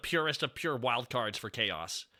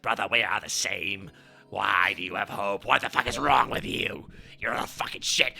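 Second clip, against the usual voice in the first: lower, menacing brotherly taunt; brooding brother; 7 semitones lower than usual. Acted. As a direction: speak low and dark to your brother. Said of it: "He's like".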